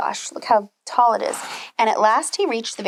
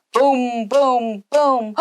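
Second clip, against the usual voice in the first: booming; 4 dB louder, much slower than usual. A narrator's reading. Giant footsteps.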